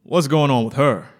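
loud, cold, dismissive doctor; arrogant doctor. Acted. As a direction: bark it without looking at her. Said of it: "his voice as hard as his body".

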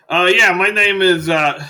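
high, loud, stalling over the name; loud hearty doctor voice. Acted. Doctor giving his name to a patient, loud and hearty.

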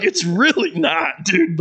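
high, slow, laughing objection; laughing protest. Retold or imitated. Answering the old factory workers, laughing. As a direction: laugh through it. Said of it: "I'm like".

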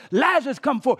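high, loud command to rise; crying out loudly. Acted. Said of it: "cries out in a loud voice".

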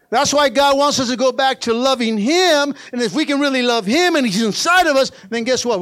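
high, loud, stretched phrase endings; full-force preaching. Real, not acted. Preacher tells church that God loves them, impassioned preaching. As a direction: drive each phrase home to the church.

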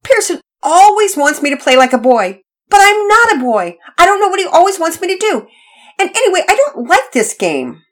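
high, loud tearful complaint; little girl wailing her grievance; about to cry. Acted. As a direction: pour out the unfairness, nearly in tears. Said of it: "sounded as though she was about to cry".

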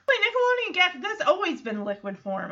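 ordinary talk

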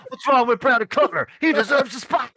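high, indignant whine; mock indignant Nick. Nick defending Brandon Cutler, high indignant whine. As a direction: play it as a wounded, put-out wrestler. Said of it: "Nick's all".

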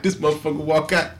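ordinary talk, nothing marked

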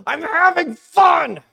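put-on very high, drawn-out, loud kid's wail; hammed-up tearful scream. Crying kid yelling back at dad, hammed-up tearful scream. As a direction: bawl it out like a miserable child. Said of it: "tears and snot".

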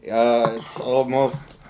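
ordinary delivery

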